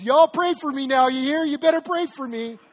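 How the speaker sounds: high, very loud Southern yell across the church; a kid hollering in a Southern drawl; desperate kid shouting in a Southern drawl. Retold or imitated. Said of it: "shouting out in his southern drawl".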